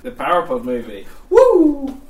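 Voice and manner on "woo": loud high cheer. Real, not acted. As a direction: whoop with excitement. Cheering film start.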